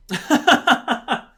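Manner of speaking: high, loud peal of laughter; laughing hard. Real, not acted. Host reacting to the grits joke, laughing hard. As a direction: crack up in high, loud bursts. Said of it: "Oh, that's funny".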